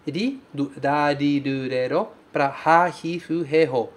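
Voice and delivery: slow, low katakana syllables read one by one; syllable drill. Shown as a demonstration. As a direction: drill the ra and ha rows slowly, apart. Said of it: "Esse katakana sim tem som de ri". (sílabas do katakana lidas devagar, uma a uma)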